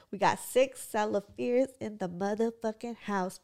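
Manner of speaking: normal talking voice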